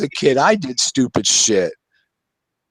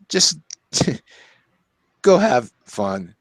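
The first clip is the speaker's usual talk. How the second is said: laughing; 3 semitones higher, slower than usual. Real, not acted. Repeats the advice, laughing.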